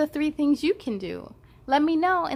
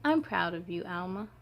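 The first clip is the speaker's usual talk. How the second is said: softer, lower proud whisper-close voice; tender praise; 5 semitones lower, 6 dB quieter than usual. Acted. Grown-up praising Alma, soft and tender. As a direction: tell her gently you're proud.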